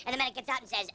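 ordinary delivery

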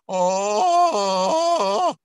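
loud high yelp breaking into falsetto; cracking register break. Shown as a demonstration. Coach demonstrates a bad break into falsetto. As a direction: flip over the break. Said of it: "from this tone to falsetto".

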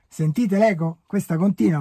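calling into cave echo. Real, not acted. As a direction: call out and listen back.

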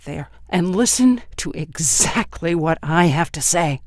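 slow, loud, hard accusation; angry accusation. A narrator's reading. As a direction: drive every word in. Son accusing mother.

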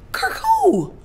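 very high-pitched mock disbelief; incredulous question. Hearing who fathered the baby, incredulous. Acted shock at baby news.

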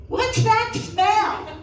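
slow, high-pitched acted boyfriend asking; boyfriend's loud question. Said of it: "What if her boyfriend says".

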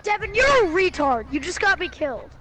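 loud furious outburst at teammate; angry yell. Real, not acted. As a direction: yell it, genuinely annoyed. Teammate got him killed.

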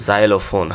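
"জাইলোফোন" starting with z, zai-lo-fon; correct pronunciation. Shown as a demonstration. Teacher revealing the fun word, correct pronunciation. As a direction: open "জাইলোফোন" on a buzzing z. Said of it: "এটা কি বলছি".

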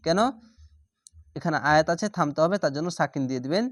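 normal talking voice

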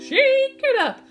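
high, loud action shout; excited call. Acted.

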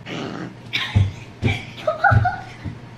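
breathy high-pitched giggle. Real, not acted. Girl giggling at the camera, high breathy giggle. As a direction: giggle before answering.

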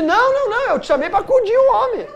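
high, loud protesting wife; mock frantic wife. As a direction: cut him off in a high, loud panic. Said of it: "E a Francine". (esposa aflita, voz alta e aguda)

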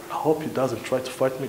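soft, mock-nervous private thought; inner-thought aside. Brother hiding his fear of a fight, inner-thought aside. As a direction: drop your volume as if confessing to yourself. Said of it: "but deep inside".

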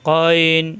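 গয়ন from the end of the throat, heavy; throaty heavy গয়ন; Arabic letter ghain. Shown as a demonstration. Teacher models heavy গয়ন from the throat. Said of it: "কণ্ঠনালীর শেষ অংশ থেকে".

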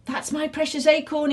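high-pitched exclamation; excited cry. A narrator's reading. Stubble spots his stolen ship, high excited cry. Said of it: "he cried".

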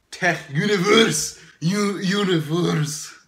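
hammed-up announcer voice; mock announcer read. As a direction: announce it like a game show host. Reading odd quiz answers aloud.

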